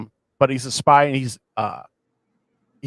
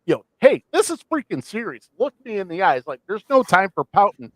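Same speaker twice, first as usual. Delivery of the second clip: raised, urgent retold scolding; acted-out impatient telling-off, pitched up; snapping at her; 7 semitones higher, 4 dB louder than usual. Team member snapping at a leader who looked down, imitated scolding. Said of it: "snaps at her".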